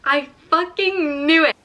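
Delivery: loud, slow, high "I fucking knew it"; real exasperated shout; frustrated outburst. Real, not acted. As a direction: blurt it out in disbelief. Reacting to the twist.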